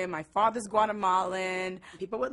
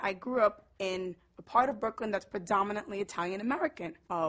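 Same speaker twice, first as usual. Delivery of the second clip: ordinary talk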